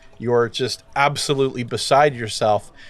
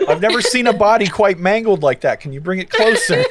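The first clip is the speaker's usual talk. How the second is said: laughing mock gawker; 7 semitones higher, 3 dB louder than usual. GM voices a gawking onlooker.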